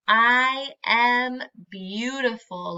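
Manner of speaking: slow, clear, every word said for the class to echo; slow and proud. Shown as a demonstration. Teacher leading the class in saying the affirmation, slowly. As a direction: say it slowly so the children can join in. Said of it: "Can you say that with our little friend".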